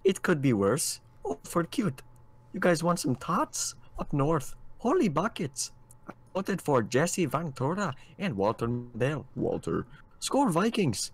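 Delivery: high, singsong exaggerated Minnesotan; Minnesotan accent. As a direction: play a chipper Minnesota local, pitch swooping. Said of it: "miss the Minnesotan stuff".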